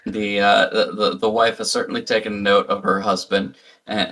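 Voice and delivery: ordinary talk, nothing marked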